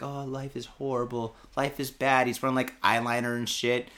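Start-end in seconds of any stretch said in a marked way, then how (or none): 0.0-2.3 put-on mopey emo kid. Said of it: "He's all depressed, like".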